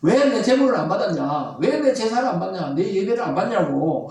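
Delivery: confrontational demand. Acted. Preacher voices Cain arguing with God, indignant and accusing. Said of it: "하나님 앞에 막 삿대질하면서 따지는".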